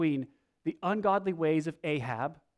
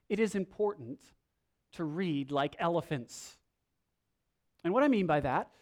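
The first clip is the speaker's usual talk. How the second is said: usual speaking voice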